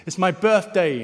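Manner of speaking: high, loud call to the moon; bear calling out. Acted. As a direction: call it out proudly from a mountaintop. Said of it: "stands up on the mountain and he says".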